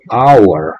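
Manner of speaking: "hour" slowly as "awar", no H; slow and clear, start "hour" on the vowel; hour sin H; silent-H hour. Shown as a demonstration. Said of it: "H muda".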